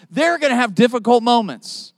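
ordinary delivery

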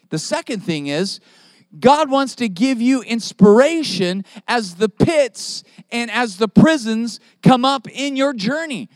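usual speaking voice

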